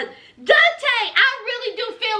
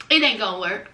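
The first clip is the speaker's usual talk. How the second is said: much lower, dragging; gloomy resignation; 10 semitones lower, much faster than usual. Retold or imitated. Heartbroken ex repeating it, lower and flat-voiced. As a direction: say it again low and defeated.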